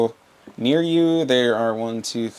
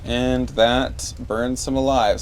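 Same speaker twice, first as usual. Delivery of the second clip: normal talking voice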